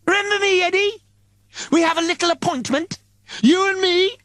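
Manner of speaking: very high, gleeful menacing voice; taunting Mister Pinksocks. Acted. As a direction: tease him like a mad grinning killer. Villain Pinksocks taunts Eddie.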